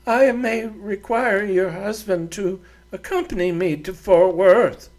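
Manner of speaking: a proud woman giving orders; haughty lady voice. Acted. Doreen's dialogue.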